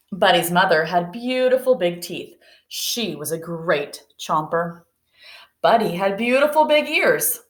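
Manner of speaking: put-on picture book read aloud to young pupils; reading to children.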